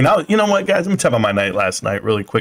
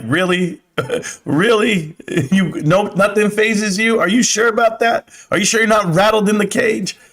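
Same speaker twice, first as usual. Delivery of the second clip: higher, teasing mock disbelief with laughs; 7 semitones higher than usual. Mocking a YouTuber who says nothing fazes him, teasing and high. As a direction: needle them, laughing at the claim. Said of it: "And you're like".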